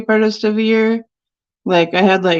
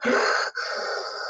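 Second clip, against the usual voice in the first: imitated heavy breathing; 11 dB quieter than usual. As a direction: mimic a painter breathing heavily into the mic. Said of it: "breathes super heavily".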